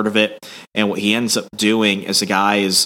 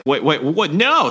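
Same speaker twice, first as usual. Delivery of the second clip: freaking out; 6 semitones higher than usual. Retold or imitated. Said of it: "she's like".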